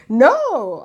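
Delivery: high, loud imitated "no"; retold emphatic refusal. Friend's firm objection, retold.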